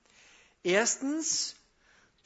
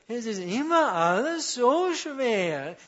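mock whimper, slow, higher and louder; whiny weepy voice; 4 semitones higher, 5 dB louder, slower than usual. Preacher imitates people who always sound weepy, mock whining. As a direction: whine it like a chronic complainer. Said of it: "die reden immer so weinend".

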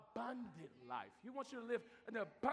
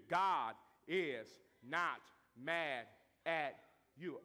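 slow, drawn out; 8 semitones lower, much slower than usual. Shown as a demonstration. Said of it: "slowed repeat".

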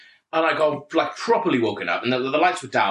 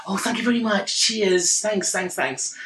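higher-pitched, breezy gratitude; over-polite thanks; 7 semitones higher than usual. Retold or imitated. Passenger ignoring sarcasm to thank parent, over-polite.

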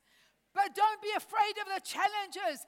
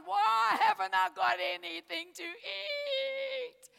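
mock whine; 3 semitones higher, 4 dB louder, slower than usual. Mock whining complaint to God.

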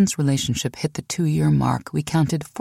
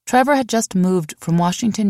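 plain reading voice

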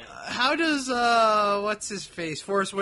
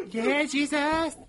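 mock cheer; 8 semitones higher, much slower than usual.

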